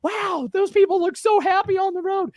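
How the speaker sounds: high, slow, dreamy gushing; mock Instagram gush. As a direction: parody a glossy travel post. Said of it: "all that style of, like".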